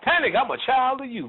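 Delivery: high, eager follower impression; mock devotee. Follower claiming to be Panic's child, mock-eager. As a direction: pitch it way up as a keen follower.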